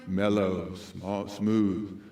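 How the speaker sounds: very low, soft, mellow; nighttime DJ voice. Shown as a demonstration. Speaker contrasts two DJ voices, mellow nighttime one. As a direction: drop into a smooth hush.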